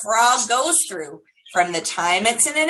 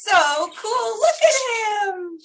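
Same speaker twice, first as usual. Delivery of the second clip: much higher, slow, gushing with delight; 10 semitones higher, slower than usual. Real, not acted. Teacher watching baby frog explore with children, delighted gush. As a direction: gush over the little frog.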